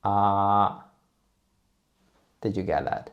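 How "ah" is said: "ah" held long on one level pitch; flat tone. Shown as a demonstration. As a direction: sustain a flat, even "ah", as for the dentist. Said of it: "very flat and even".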